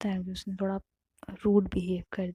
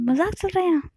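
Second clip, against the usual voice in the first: much higher incredulous snap; indignant; 10 semitones higher, 4 dB louder than usual. Acted. Aarti snapping at Navya, high indignant question. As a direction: sound offended.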